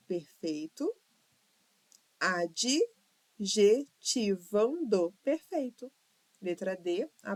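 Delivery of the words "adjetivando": "Adjetivando" slowly as ad-je-ti-van-do; syllable by syllable. Shown as a demonstration. Teacher checking syllable division, "Adjetivando" syllable by syllable. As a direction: break "Adjetivando" up with gaps and a full final O. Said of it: "divisão silábica de todas as palavras".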